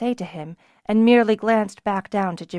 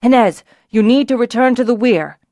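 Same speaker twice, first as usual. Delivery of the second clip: loud call across the landing; 3 semitones higher, 8 dB louder than usual. A narrator's reading. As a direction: raise the voice to carry to him. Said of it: "he called out".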